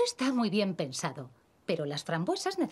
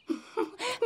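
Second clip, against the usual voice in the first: risita tierna; 4 dB quieter than usual. Acted. Mamá ríe ante la ocurrencia.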